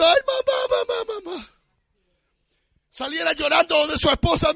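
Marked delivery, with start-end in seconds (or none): 0.0-1.5 imitating a man wailing in grief; mock wailing. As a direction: ham up a grown man bawling for his mother.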